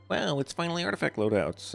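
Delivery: high-pitched mock-excited fan reaction; imitated excitement. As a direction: imitate players getting their hopes up, pitched well above your own voice. Mimicking hopeful players' first reaction.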